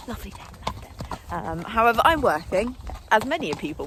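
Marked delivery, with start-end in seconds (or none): 0.1-1.2 breathy hushed repeat; whispered lovely day; soft aside. Real, not acted. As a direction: murmur it to yourself, dreamily. Dreamy aside about the day.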